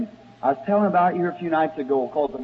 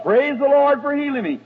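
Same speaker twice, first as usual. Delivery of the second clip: hollered; 9 semitones higher than usual. Retold or imitated. Healed man hollering praise during the service, high shout. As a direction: shout it out from the seat. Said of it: "He raised up and hollered".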